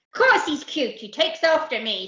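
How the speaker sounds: loud, boastful pirate voice; proudly. Acted. Captain admiring his son, loud pride. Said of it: "said Captain Abdul proudly".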